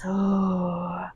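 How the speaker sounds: soft sighed "ooh"; exhaling collapse. Shown as a demonstration. Guide collapses out of big stretch, soft sigh. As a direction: let the breath sink out. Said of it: "exhale, collapse".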